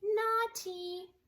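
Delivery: a cub tutting; high little baby bear. Acted. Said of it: "said Baby Blair".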